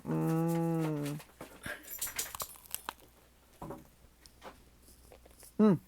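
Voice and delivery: chewing while humming; mouth full. Real, not acted. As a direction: hum through a bite of bread. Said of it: "now I'm chewing in your ear".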